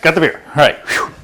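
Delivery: panting after a quick dash; breathless from hurrying; out of breath. Real, not acted. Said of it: "Cover me, I'm out of breath".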